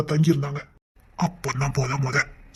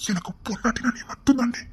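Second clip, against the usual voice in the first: unvoiced tender whisper; whispered reassurance; 6 semitones higher, 5 dB quieter than usual. Husband whispers reassurance to his upset wife. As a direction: whisper it close to her.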